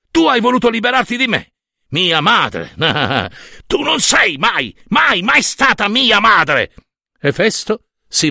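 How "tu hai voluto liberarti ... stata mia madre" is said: high outburst with a scornful laugh; anguished, furious rejection; bitter accusation. A narrator's reading. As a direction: hurl it at her, slowing on the last words.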